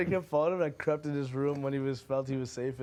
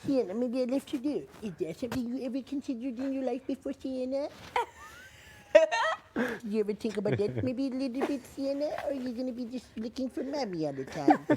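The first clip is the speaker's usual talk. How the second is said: teasing mom voice; 8 semitones higher than usual. Pretend. Voicing mom teasing toddler.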